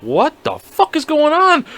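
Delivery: high, slow, incredulous question; retold bewilderment. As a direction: draw it out in high-pitched disbelief. Retold bewilderment during evacuation.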